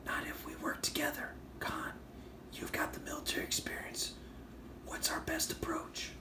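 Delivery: high, soft whispered voice effect; altered character voice. Acted. Reviewer reading a comic character's line in a high whispery effect voice. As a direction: whisper it high through a voice changer. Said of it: "I'm gonna do the voice".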